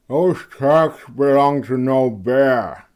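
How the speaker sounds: slow, loud through a mouthful of bread; mouth full. A narrator's reading. Heinrich interrupts the hunters, mouth full of bread. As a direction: speak around a bite of bread. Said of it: "with a mouthful of bread".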